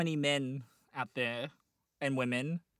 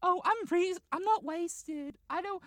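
tipsy lady character; 12 semitones higher than usual. Acted. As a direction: protest as a mock lady who's been drinking. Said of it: "if she's still a little bit".

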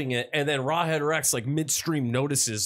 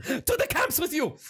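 high-pitched cartoon German command; mock German order; 11 semitones higher than usual. Joker sending his cohosts away, mock German order. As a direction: banish them in a silly German voice.

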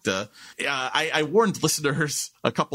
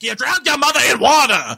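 high, loud New York yell with no r's; outraged New Yorker shouting; Martin; 11 semitones higher, 10 dB louder than usual. Acted. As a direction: bellow it in thick New York accent. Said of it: "Martin in that scene would be like".

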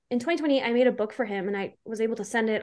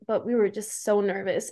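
usual speaking voice

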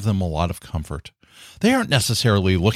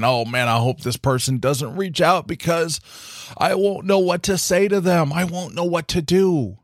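higher-pitched imitation of inner worry; mock worry; 6 semitones higher than usual. Speaker admitting private doubts about helping someone, mock worried. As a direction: let the pitch climb as you voice mild dread.

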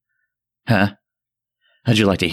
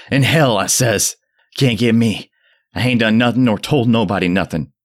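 high frantic cackling scream; a madman laughing as he yells; 8 semitones higher, 3 dB louder than usual. Acted. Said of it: "Zadok was really screaming now".

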